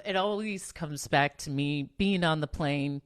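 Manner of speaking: usual speaking voice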